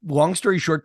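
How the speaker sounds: normal talking voice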